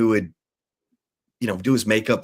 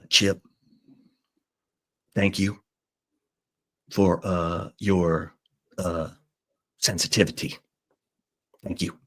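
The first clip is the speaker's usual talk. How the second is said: halting thanks; 2 semitones lower, 5 dB quieter than usual. Retold or imitated. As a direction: imitate a man measuring out a terse thank-you. Imitating Jerry's halting thanks.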